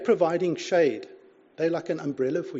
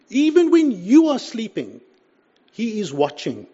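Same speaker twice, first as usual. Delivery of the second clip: loud and higher, pressing the point; emphatic preaching; 5 semitones higher, 9 dB louder than usual. Acted. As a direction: proclaim it to the room. Preaching climax on God watching.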